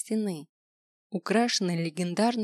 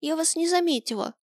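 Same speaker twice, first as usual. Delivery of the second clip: high, panicked pleading; terrified horror victim; 9 semitones higher than usual. A narrator's reading. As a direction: beg in fear, pitch up. Girl apologizes to threatening dragon. (испуганная мольба)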